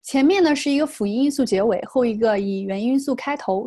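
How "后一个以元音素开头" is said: ordinary talk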